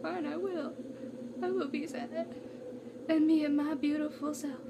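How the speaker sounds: small, high, soft voice; mock sulking. Leo left alone to be sad, acted soft sulk.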